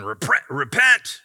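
loud, slow hellfire cry of repent; mock street-corner preacher yelling repent; angry street preacher. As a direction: bellow it like a street-corner preacher condemning passersby. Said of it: "the angry preacher on the scre-, street corner going".

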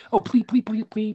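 breathless, high pleading; frantic begging. Pretend. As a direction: beg for your life in a panic. Character begs for life.

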